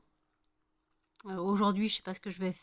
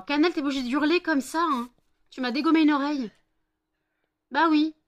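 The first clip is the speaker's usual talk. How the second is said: higher, louder outraged scolding; loud complaint; 6 semitones higher, 8 dB louder than usual. Real, not acted. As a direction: complain loudly at the dog. Dog howled in her ear.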